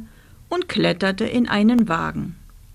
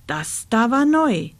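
ordinary delivery

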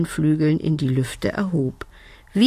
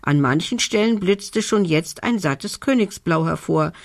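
ordinary delivery

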